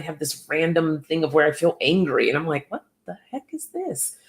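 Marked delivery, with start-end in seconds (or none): 2.7-4.2 much higher and softer, baffled; a startled, quiet what-the-heck; puzzled inner voice. Retold or imitated. Acting her own puzzled thought.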